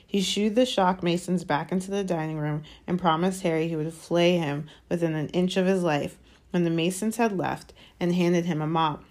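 ordinary delivery, nothing marked

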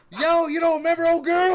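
high, loud mock holler; hollering from afar. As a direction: yell it across the house, imitating a big-mouthed friend. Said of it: "so Buck hollers from the kitchen".

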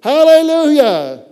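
loud, high, drawn-out hallelujah; slow, exultant high shout; shouted praise. Acted. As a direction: holler it out in joyful praise. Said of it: "Jeff started shouting".

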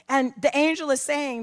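normal talking voice

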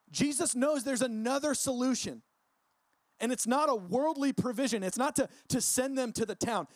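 preacher building to his point; preaching emphasis. Real, not acted. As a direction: pitch it up and press each point. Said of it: "preaching emphasis".

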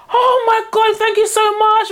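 high-pitched imitated thanks from a relieved mother; mock gushing gratitude. Grateful woman after buggy help.

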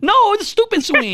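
high-pitched outraged correction; yelling. Retold or imitated. Tough-love parent to a kid who struck out, yelling. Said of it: "Like, yelling at him".

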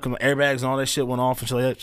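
ordinary delivery

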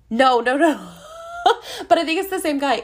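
high, loud, breaking into laughter; words tumbling out around a laugh; laughing self-correction. Real, not acted. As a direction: laugh at your own mistake. Laughs at mixing up the musicals.

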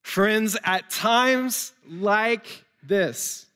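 normal talking voice

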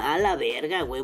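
usual speaking voice

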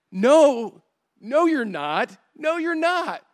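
high, loud, insistent no; stunned denial. Retold or imitated. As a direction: refuse to believe it, voice jumping up. Diner owner in disbelief.

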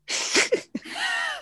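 high squealing burst of laughter; shrieking laugh. Real, not acted.

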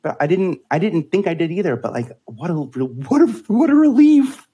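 building from plain to an excited high cry; relieved outburst. Retold or imitated. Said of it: "I go".

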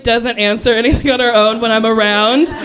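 normal talking voice

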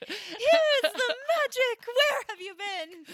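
high, loud, slow, stretched-out delight; mock gushing praise. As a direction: swoon over it like a dazzled fan. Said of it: "encourage our friends like that".

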